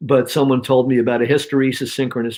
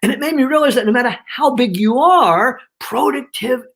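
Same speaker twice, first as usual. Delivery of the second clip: higher, punched HOW BIG YOU ARE and PRODUCTIVE; emphasis on the point; 8 semitones higher, 3 dB louder than usual. Shown as a demonstration. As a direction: raise your voice and stress "productive". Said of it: "So I put the emphasis on that".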